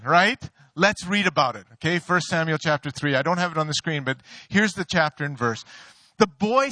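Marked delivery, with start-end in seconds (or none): none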